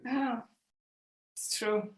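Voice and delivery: usual speaking voice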